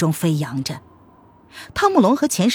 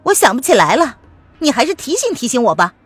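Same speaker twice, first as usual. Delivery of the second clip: higher, louder, pointed questioning; taunting young woman; 6 semitones higher, 5 dB louder than usual. Acted. Lili challenges the prince's hidden motive, taunting. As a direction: needle him with accusations.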